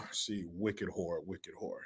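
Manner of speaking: a lightly voiced, quiet, slow put-on; a warm-up clearing into the voice; Gabrielle Union impression. Comic warming up to play Gabrielle Union, low-key put-on. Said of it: "let me do an impression of Gabrielle Union".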